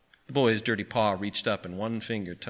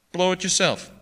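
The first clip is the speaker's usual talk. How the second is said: cold retort at raised pitch; 9 semitones higher, 4 dB louder than usual. A narrator's reading. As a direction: brush him off coldly. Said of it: "broker coldly".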